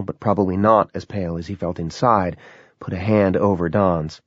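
plain reading voice

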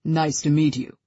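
lightly voiced deep-voiced driver; a hint of a Batman-like deep voice; Duran's deep voice. A narrator's reading. Driver greeting Ella, a lightly deepened voice. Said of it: "Duran says in a ridiculously deep voice".

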